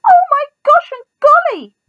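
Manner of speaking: high, loud shocked exclamation; startled cry. A narrator's reading. Startled elephants crying out at Elmer's boo. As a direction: yelp it in total surprise. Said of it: "fell all ways in surprise".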